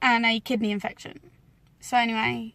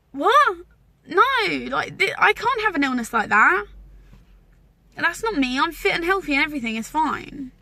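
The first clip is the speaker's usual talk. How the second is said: panicked disbelief; 6 semitones higher, 3 dB louder than usual. Retold or imitated. Retelling denial of the diagnosis.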